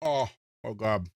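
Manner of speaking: ordinary delivery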